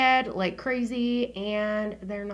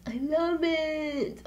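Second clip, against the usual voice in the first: higher, slow swoon over it; drawn-out gush; 5 semitones higher, much slower than usual. Real, not acted. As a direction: stretch the words out dreamily. Repeating her love of phone.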